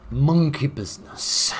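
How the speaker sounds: loud, high, drawn-out proclamation; theatrical announcement. Acted. Gamer announcing the monkey theme to viewers, theatrical and drawn-out. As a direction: announce it slowly with a big, hammy flourish.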